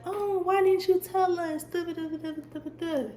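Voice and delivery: high mocking staff voice, trailing into da da da; mocking school staff. Mocking school staff who ignored her complaints, high and sneering. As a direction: sneer at the teachers who never helped. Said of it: "they'll be like".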